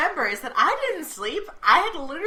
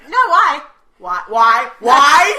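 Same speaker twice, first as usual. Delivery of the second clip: 1 frantic why; 11 dB louder, much slower than usual. Retold or imitated. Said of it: "I said".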